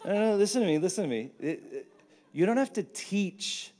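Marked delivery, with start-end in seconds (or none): none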